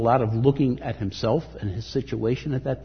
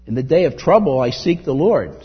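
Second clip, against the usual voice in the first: preaching scripture; 3 semitones higher, 7 dB louder than usual. Acted. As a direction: declaim each word of the verse. Reads psalm with force.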